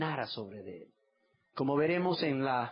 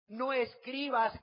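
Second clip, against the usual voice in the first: priests demanding; 6 semitones higher, much slower than usual. Acted. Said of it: "Dijeron a Pilato los principales sacerdotes".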